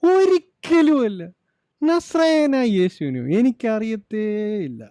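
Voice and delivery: high-pitched, protesting denial; emphatic denial. Acted. Peter disowning Jesus when accused, emphatic denial. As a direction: deny it outright, voice pushed up high. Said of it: "He denied".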